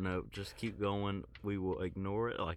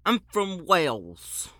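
loud, high, slow attempt at a Welsh accent; failed Welsh accent; 8 semitones higher, 10 dB louder, slower than usual. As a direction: badly fake a Welsh accent. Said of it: "I can't do a British accent".

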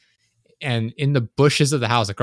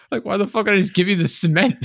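indignant outburst; 10 semitones higher, 5 dB louder than usual. Retold or imitated. Stepdad refusing neighbor, indignant.

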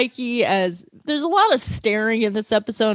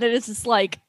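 ordinary talk, nothing marked

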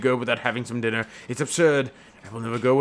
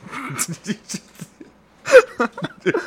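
laughing; 9 semitones higher, 5 dB louder, much slower than usual. Acted. Savoring muffins in the scene.

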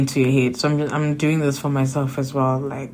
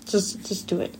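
breathy whispered urging; 4 semitones higher, 8 dB quieter than usual. Real, not acted. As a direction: drop to a soft whisper for the last push. Repeating advice, then drops to whisper.

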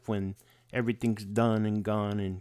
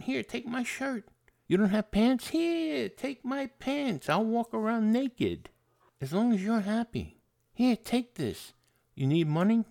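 higher eager giving voice; a pushover offering his shirt and money, high and eager; overly generous; 8 semitones higher than usual. Retold or imitated. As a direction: hand everything over in an eager high voice. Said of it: "generous to a fault".

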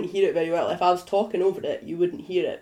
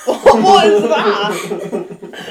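loud, drawn-out laughing question; slow words swamped by loud laughter; laughing disbelief; 11 dB louder, much slower than usual. Real, not acted. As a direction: crack up while asking. Laughing at friend's accent attempt.

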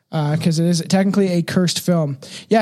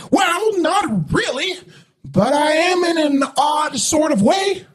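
much higher, slow put-on voice for Sam; voiced Sam; 9 semitones higher, slower than usual. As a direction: make Sam sound odd and otherworldly. Reading Sam's answers in voice.

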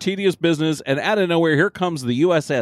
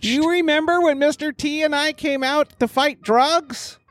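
PSA announcer; 8 semitones higher than usual. Acted. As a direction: read like an old anti-drug ad. Anti-drug PSA parody.

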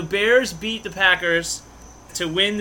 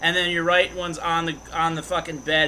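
ordinary delivery

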